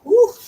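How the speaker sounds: loud high whoop. Real, not acted. Reacting to the onion's sting, loud high whoop. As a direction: let out a sudden loud woo.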